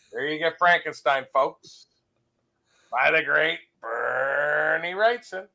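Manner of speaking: slow, stretched-out presenter's flourish; slow, savored name reveal; drawn-out showman. Real, not acted. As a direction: linger on the artist's name like an announcer.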